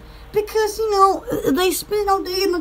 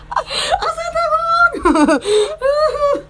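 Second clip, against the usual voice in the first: giggling through the words, pitch jumping high; laughing; 9 semitones higher, much slower than usual. Real, not acted. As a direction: laugh it out at your own blunder. Laughing at own slip.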